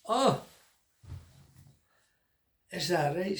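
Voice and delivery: a high, loud incredulous king; king scoffing at the claim; mock surprised king. King to the fat man, high incredulous scoff.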